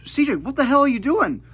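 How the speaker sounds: high, loud angry mom yelling; furious mom. Retold or imitated. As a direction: mom bursting in, shouting at her kid. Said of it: "she was furious".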